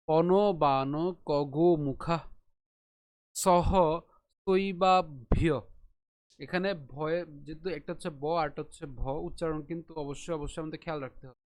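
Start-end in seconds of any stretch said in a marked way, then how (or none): 0.1-5.4 slow, split পন বান কগো মুখা; broken into syllables. Shown as a demonstration. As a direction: chant each part separately. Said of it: "ভেঙে উচ্চারণ করি". (ধীরে ভেঙে উচ্চারণ)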